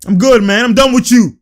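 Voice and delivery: loud, high mock dismissal; imitating fed-up player. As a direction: act out a player storming off from the ref. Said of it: "He's like".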